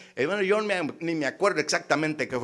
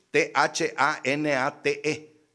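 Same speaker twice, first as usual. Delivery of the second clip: slow letter-by-letter, slightly raised; spelling out; 4 semitones higher, 3 dB louder, slower than usual. Shown as a demonstration. Preacher teaching the opponent a Greek word, slow spelling. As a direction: spell each letter deliberately.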